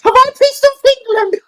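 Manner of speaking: very high, loud squeak through laughter; giggly falsetto panic; laughing squeal. Real, not acted. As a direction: squeal it out while cracking up.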